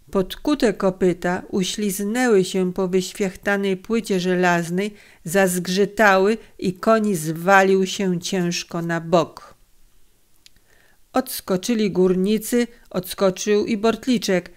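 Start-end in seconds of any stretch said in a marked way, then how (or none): none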